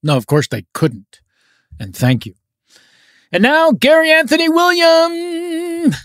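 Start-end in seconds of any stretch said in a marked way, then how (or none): 3.4-5.9 booming prize announcer. Acted. Host introducing next contestant.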